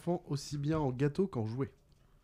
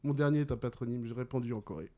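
quick, lower-key rereading; brisk recap; 3 semitones lower, faster than usual. Real, not acted. Quizmaster repeats the last clue to players, briskly. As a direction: run through the clue without pausing.

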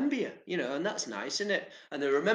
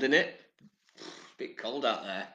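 ordinary talk

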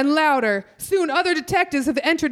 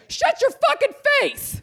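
mock outburst; 10 semitones higher than usual. [name] snaps at Elliot.

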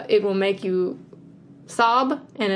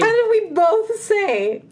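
high, drawn-out incredulous question; astonished; 11 semitones higher, 4 dB louder, slower than usual. Real, not acted. Co-host asks how both picked the same actor, astonished. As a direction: stretch it out high, utterly amazed.

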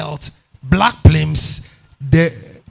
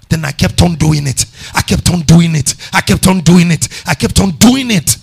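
louder and louder each time; building emphasis; 9 dB louder than usual. Acted. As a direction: hammer it home, growing each time. Preacher repeats with rising force.

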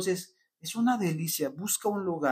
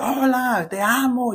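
much higher and louder, gushing with emotion; excited greeting; 10 semitones higher, 5 dB louder than usual. Retold or imitated. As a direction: greet your loved one all thrilled and high. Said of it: "ah, no te emocionas, dices". (saludo emocionado, mucho más agudo y fuerte)

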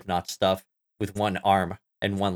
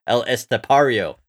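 American accent; 5 dB louder than usual. Shown as a demonstration. Settling for saying it like an American, American accent. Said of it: "We can say it like an American".

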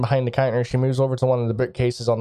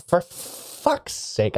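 grumbling under breath; 6 semitones higher, 4 dB quieter, much slower than usual. Acted. Shopkeeper startled by door clang.

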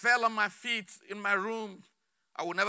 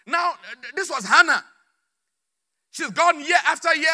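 loud, high pulpit delivery; impassioned preaching; 4 semitones higher, 9 dB louder than usual. Real, not acted. Preacher telling the congregation Hannah's story, impassioned. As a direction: preach it hard, driving each phrase home.

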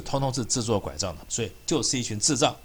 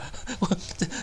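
scoffing laugh; 7 semitones higher, 7 dB quieter than usual. Real, not acted. Mocking laugh after his point.

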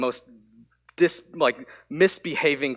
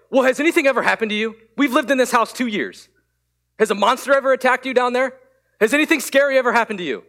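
fast, exasperated mock lecture of a scolding dad; railing on her; 6 semitones higher, 3 dB louder, faster than usual. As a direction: reenact the impatient tirade, quick and pushy. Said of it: "I just railed on her".

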